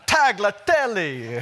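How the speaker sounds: mock Italian accent, slowed, laughing a little; playful Italian accent. Shown as a demonstration. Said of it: "You've got to say it with an Italian accent".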